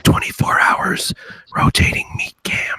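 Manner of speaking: hammed-up ASMR whisper; joking whispered promo; mock ASMR whisper. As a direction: whisper the pitch like an ASMR video. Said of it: "you gotta whisper".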